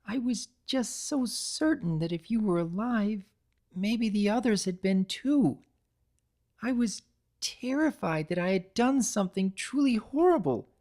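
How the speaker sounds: high, remorseful pony voice; Pinkie's voice. A narrator's reading. Pinkie begs Kitty's forgiveness, high and sorry.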